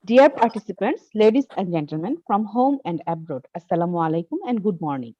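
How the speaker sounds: slow, measured conference welcome; formal opening speech. Acted. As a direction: address the hall slowly and formally.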